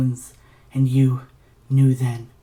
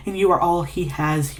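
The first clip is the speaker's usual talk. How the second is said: ordinary delivery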